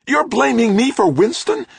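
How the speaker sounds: very high, loud screech; outraged screech. A narrator's reading. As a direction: screech it with temper boiling over. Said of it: "Trudy screeched, her temper boiling now".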